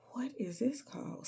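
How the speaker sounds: unvoiced, bewildered whisper; a hushed what-is-this; whispered aside. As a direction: mutter it to yourself in disbelief. Her bewildered aside retold.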